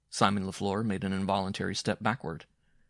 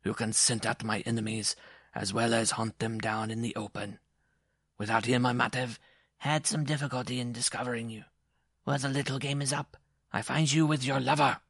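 higher character voice, rising in force; accusing dwarf husband; 4 semitones higher than usual. A narrator's reading.

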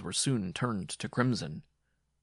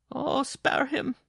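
slow, very high pleading wail; imploring; 5 dB louder, slower than usual. A narrator's reading. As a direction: beg for mercy in a high cry. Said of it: "Jean Marie implored".

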